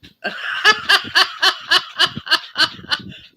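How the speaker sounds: high airy laugh. Real, not acted. Host laughing at James's basement bedroom. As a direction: giggle at the news.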